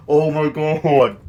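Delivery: loud, long drawn-out gasping disbelief; dramatic shock. Real, not acted. Recapper reacting to the mother overhearing everything, dramatically shocked. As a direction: stretch every word out in scandalized shock.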